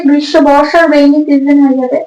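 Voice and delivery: ordinary delivery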